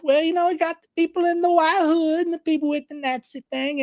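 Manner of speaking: high, drawling Southern carpetbagger voice; Southern plantation owner accent. Retold or imitated. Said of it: "the carpetbagger accent, the plantation owner accent".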